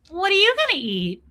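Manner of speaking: high, innocent question; naive question. Retold or imitated. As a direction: ask it like a clueless kid. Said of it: "And I am so stupid".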